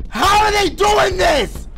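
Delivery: loud, high and slow disbelief; shocked shout. Real, not acted. Player stunned by the level, shouting slowly. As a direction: yell it in amazement.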